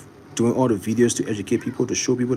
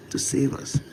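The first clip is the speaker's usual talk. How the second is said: whispered; slower than usual. Shown as a demonstration. Trailing whisper of the motto.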